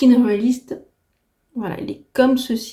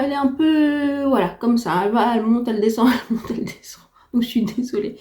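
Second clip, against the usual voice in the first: cracking, breaking voice slipping into whisper; voice giving out; 3 semitones higher than usual. Real, not acted. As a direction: voice going up and down, giving out. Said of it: "si ma voix part un peu".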